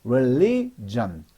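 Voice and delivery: religion drawn out syllable by syllable; slow demo word. Shown as a demonstration. Teacher models religion slowly for students. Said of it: "어 발음 난다고 보세요".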